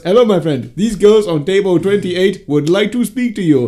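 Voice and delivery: imitating the bouncer's African accent, slowly; a hint of a friendly African accent. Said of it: "really charming African accent".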